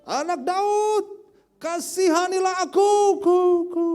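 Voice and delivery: high, long-drawn shout. Acted. Blind beggar shouting harder for mercy, drawn-out cry. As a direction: holler each word long, with an echo. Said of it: "semakin keras ia berseru".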